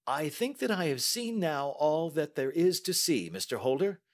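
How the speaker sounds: a brisk, confident Holmes; the detective testing the banker, lightly voiced; Holmes, cool and measured. A narrator's reading. Holmes voiced while reading.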